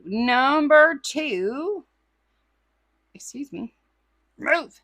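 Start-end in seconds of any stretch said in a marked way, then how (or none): 0.0-1.8 slow, sing-song number call; drawn-out announcement. Acted. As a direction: stretch it out, slow and rising. Drawn-out announcement of next item.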